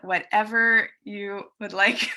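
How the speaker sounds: ordinary talk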